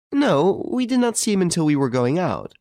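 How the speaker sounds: put-on high-pitched old lady on the telephone. A narrator's reading.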